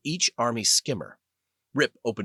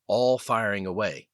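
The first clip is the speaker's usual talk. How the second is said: usual speaking voice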